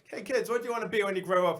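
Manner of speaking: imitated kids' show host; talking to kids. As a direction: chirp a question to a group of kids. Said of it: "he was talking to kids".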